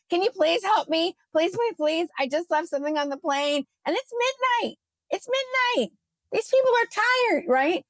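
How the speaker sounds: high-pitched retold begging; playful imitation of a frantic plea; pleading. Begging attendant for help.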